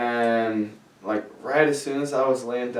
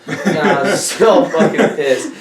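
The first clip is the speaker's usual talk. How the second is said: laughing, higher, louder and slow; mock outrage breaking into laughter; 7 semitones higher, 8 dB louder, slower than usual. Real, not acted. Laughing about the raid.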